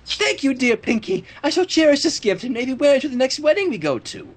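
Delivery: a hint of grand gratitude; lightly voiced Rarity.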